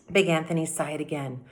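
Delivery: ordinary delivery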